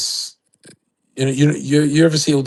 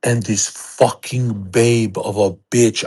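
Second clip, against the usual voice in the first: ordinary delivery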